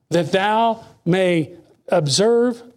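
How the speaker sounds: very slow, weighty verse; solemn slow recitation; slow scripture reading. Acted. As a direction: stretch each word of the verse. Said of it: "He said the only thing".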